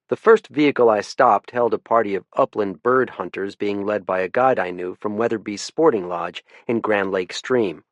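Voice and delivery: usual speaking voice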